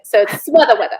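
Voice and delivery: normal talking voice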